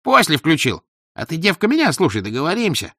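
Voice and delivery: high, insistent bystander voice; scheming tenor. A narrator's reading. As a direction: urge the girl to play along. Scheming tenor instructs the girl.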